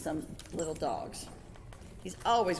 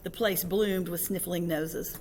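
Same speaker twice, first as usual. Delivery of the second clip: ordinary delivery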